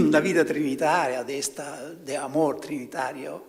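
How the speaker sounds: usual speaking voice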